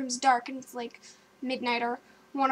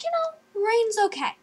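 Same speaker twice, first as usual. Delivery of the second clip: very high, airy acceptance; acted-out pretence of being fine, pitched up; high-voiced self-reassurance; 8 semitones higher than usual. Storyteller hearing pouring rain, high-pitched self-reassurance.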